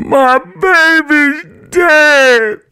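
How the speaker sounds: very high, loud, slow wail. A narrator's reading. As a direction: wail out the loss. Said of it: "my mother wailed".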